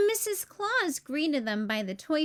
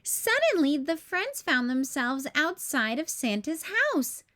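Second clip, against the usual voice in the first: plain reading voice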